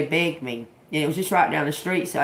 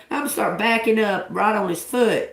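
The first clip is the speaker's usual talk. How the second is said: ordinary talk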